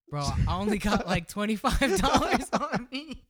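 high, fast, giggly excuse; laughing excuse. Retold or imitated. The guy at his car telling them he only has a little cash, laughing. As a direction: say it laughing, sheepish. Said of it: "and he goes".

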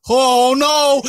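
loud, high-pitched drawn-out oh no; mock dismay. Friend realizing his accident mid-laugh, loud mock dismay. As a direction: imitate a friend's big dismayed cry.

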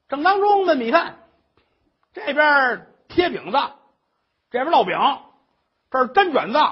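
loud, high nagging tirade; shrill scolding old mother. Acted. Mother-in-law scolds the crying daughter-in-law, loud and high.

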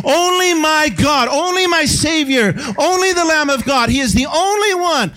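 high, loud shouted praise; preaching at full force. Acted. Pastor proclaiming to congregation, full shout. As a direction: cry it out to the church.

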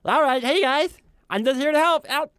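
very high, sweet cinnamon-roll voice; tiny, eager-to-help high squeak; Rolda. Shown as a demonstration. Player introducing his kobold cleric, very high sweet voice. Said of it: "she talks like this".

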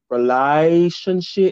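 put-on "Relationship" slow, long and drawn out in a Birmingham accent; stretched Brummie word. Host mimicking her friend's drawn-out Birmingham "Relationship". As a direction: drag out "Relationship" Brummie style. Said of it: "he made it worse by extending the tone on it".

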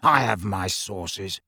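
slow, low smug hiss; self-satisfied hiss. A narrator's reading. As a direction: hiss it, pleased with yourself. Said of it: "in a self-satisfied hiss".

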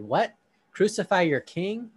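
can't believe what he hears; perplexed. Acted.

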